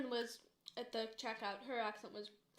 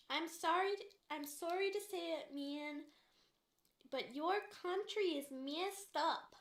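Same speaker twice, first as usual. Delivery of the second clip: higher, drawn-out mock Canadian; exaggerated Canadian cashier, pitched up; Canadian accent imitation; 5 semitones higher, slower than usual. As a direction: imitate a proud Canadian, stretching the vowels. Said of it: "I can't imitate it, but the way she had it".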